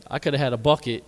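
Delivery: usual speaking voice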